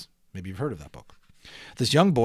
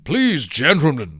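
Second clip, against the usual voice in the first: louder, slow, indignant rebuke; angry mayor; 3 semitones higher, 7 dB louder, slower than usual. A narrator's reading. Mayor scolding the fallen inventors, angry and loud. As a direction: scold them sharply. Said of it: "said the mayor angrily".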